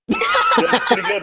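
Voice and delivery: laughing. Real, not acted. Laughing at own voice.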